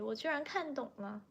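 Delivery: ordinary delivery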